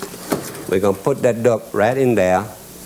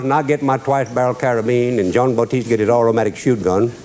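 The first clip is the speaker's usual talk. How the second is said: usual speaking voice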